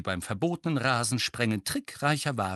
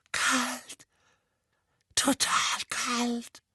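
tearful; 13 semitones higher, much slower than usual. A narrator's reading. Dudley reliving terror.

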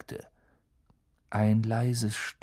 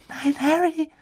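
ordinary delivery, nothing marked

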